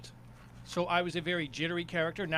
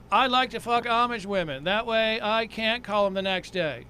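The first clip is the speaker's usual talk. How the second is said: higher-pitched sing-song joke delivery; sing-songy stage character; 3 semitones higher, 4 dB louder than usual. Shown as a demonstration. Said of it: "perform it sing-songy like my character".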